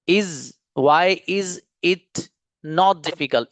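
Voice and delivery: slow, each word of "why is it not" set apart. Shown as a demonstration. Teacher showing the class what is written, word by word. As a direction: spell out "why is it not" as four separate words. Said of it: "देखो is यहाँ पर जो लिख रहा है ना".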